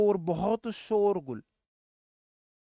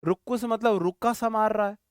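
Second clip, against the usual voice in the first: usual speaking voice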